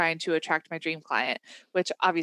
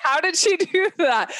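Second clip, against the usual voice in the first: high, louder mock astonishment; amazed disbelief; 10 semitones higher, 5 dB louder than usual. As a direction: act out a baffled, wowed question. Acting out listener's amazed thought.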